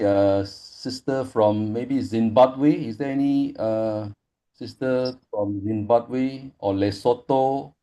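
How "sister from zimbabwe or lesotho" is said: ordinary delivery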